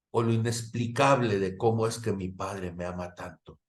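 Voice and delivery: ordinary talk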